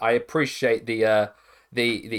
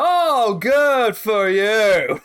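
sarcastic; 8 semitones higher, 6 dB louder, much slower than usual. Acted. Sarcastic reply to a boast.

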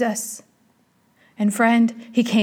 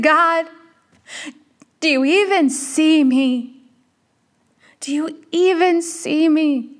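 higher, slow plea to God; mildly pitched-up, drawn-out appeal; retold crying out to God; 3 semitones higher, slower than usual. Woman retells calling out to God in despair, pleading. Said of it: "calling out to God out loud".

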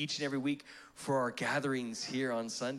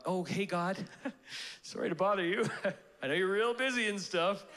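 mock-timid, chuckling politeness in a raised pitch; passive, apologetic; 5 semitones higher than usual. Overly polite Canadian asking God a favour, mock-apologetic. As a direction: hesitantly excuse yourself for bothering someone. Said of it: "we can be a little bit passive".

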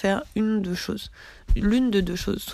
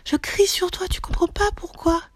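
much higher, half-yelled imitation of snapping; mock sudden outburst; 11 semitones higher than usual. After holding anger in, snapping at the other person in a mock outburst. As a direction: act out finally losing it at someone. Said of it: "je pète un câble".

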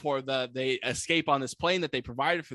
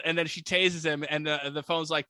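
ordinary delivery

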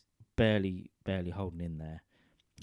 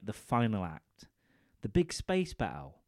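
ordinary talk

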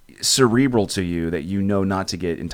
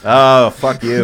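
laughing mock outrage; 3 semitones higher, 8 dB louder, much slower than usual. Real, not acted.

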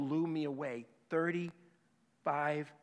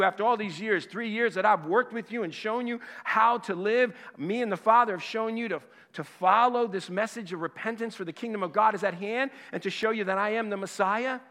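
louder and higher, a sustained indignant tirade; loud wounded reproach; angry rebuke; 6 semitones higher, 6 dB louder than usual. Acted. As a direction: keep hammering the betrayal home. Jesus rebuking abandoning disciples.

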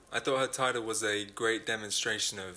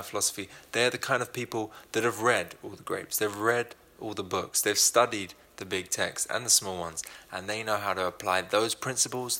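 upbeat, louder hype of an ad spot; sponsor read; 6 dB louder than usual. Acted. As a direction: sell it like a playful announcer. Host reads sponsor ad.

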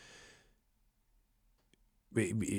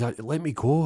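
higher-pitched mock plea to be released; acted alarmed plea; 7 semitones higher, 4 dB louder than usual. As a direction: play a tied-up captive begging, voice jumping up. Tied-up captive demands release.

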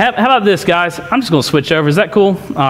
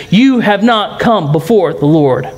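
ordinary talk

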